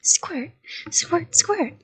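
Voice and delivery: high playful "squirt, squirt, squirt". Acted. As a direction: squeak out each squirt like a toy. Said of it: "I said again".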